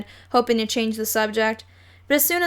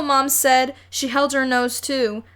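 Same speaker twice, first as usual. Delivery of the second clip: ordinary delivery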